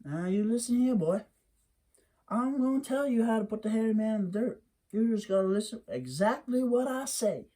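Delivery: a hint of a no-nonsense mother; stern Mammy. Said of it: "Mammy said".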